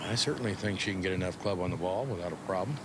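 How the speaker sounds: ordinary delivery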